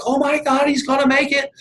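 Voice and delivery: high-pitched crowd exclamation; amazed crowd. Retold or imitated. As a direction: squeal it like an astonished onlooker. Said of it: "and everybody's like".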